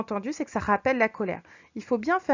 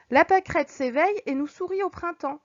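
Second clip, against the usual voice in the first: higher, bright cheerful delivery; joyful reading; 7 semitones higher, 4 dB louder than usual. Shown as a demonstration. Teacher reads the daisy example joyfully. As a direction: read it like a happy spring morning. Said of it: "c'est assez joyeux".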